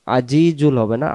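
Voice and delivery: normal talking voice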